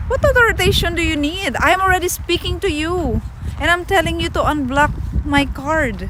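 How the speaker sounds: high exasperated customer. Retold or imitated. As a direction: demand it back at the bank, high and fed up. Angry retold complaint to bank.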